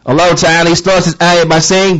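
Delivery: ordinary talk, nothing marked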